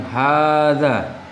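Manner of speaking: hadza with long a, said slow and clear; teacher modelling hadza; model pronunciation. Shown as a demonstration. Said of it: "saya ulang".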